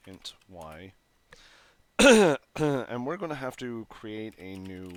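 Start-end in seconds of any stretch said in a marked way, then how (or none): 2.0-2.5 a sharp loud cough; real cough while coding. Real, not acted. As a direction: cough hard, chesty and phlegmy. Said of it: "super phlegmy tonight".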